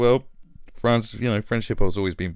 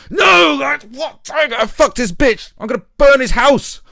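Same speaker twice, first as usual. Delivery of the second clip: high, loud, outraged squawk; mock angry rant; 10 dB louder than usual. Mocking [name] raging at [name], high and loud. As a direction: ham up a furious rapper.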